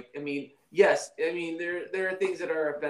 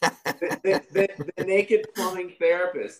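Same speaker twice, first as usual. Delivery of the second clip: louder, hammed-up show title; mock TV announcer; 5 semitones higher, 6 dB louder than usual. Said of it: "I get naked and I say".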